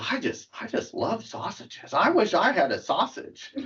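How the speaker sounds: a hint of a silly wife longing for sausage; wife wishing. Wife's wish in the joke.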